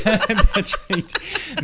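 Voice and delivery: high, slow, through real laughter; laughing. Real, not acted. Laughing at the joke.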